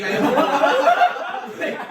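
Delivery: high, loud, drawn out through laughter; laughing confession. Real, not acted. Student telling how they hurt teacher's leg, helpless laughing. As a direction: crack up while owning up.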